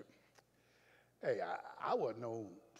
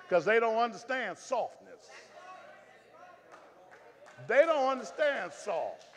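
loud, driving preacher's cadence; fired-up pastor pushing the crowd to be firm; preaching at full force; 4 semitones higher, 11 dB louder than usual. Acted. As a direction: hammer each point home from the pulpit.

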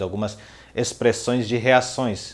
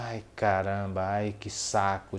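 regretful tone; 4 dB quieter than usual. Retold or imitated. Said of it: "num tom de arrependimento".

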